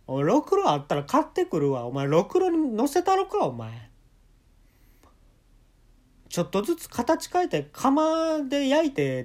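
hoog en luid nagespeeld dreigement; dreigende tirade. Retold or imitated. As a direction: dreig hamerend als een doorgedraaide vader. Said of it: "普通に怖いな".

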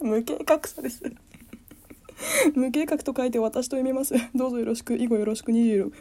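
high, hammed-up greeting voice; mock formal self-introduction. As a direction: introduce yourself like a stage act.

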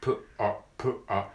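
プ、ア split into lip pop then R; P then R drill. Shown as a demonstration. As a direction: say プ and ア as two quick separate sounds. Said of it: "連続でやります".

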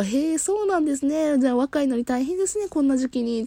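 high, friendly small-talk voice; chatty clerk. Retold or imitated. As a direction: make sympathetic small talk. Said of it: "とか言いながら".

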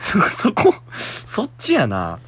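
laughing through the words. Real, not acted. Host cracking up at the listener's letter. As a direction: crack up mid-sentence.

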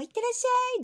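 high bright send-off; sing-song see-you-off; cheery farewell. Retold or imitated. Girl imitating family farewell. (明るく高く「いってらっしゃい」)

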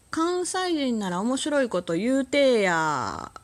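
high, loud, drawn-out Kansai demand; hammed-up Kansai teasing request; fake Kansai dialect. Shown as a demonstration. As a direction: put on a slow mock Kansai accent. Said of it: "エセ関西弁十".

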